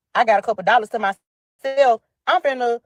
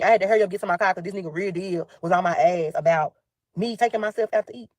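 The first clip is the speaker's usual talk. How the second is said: ordinary delivery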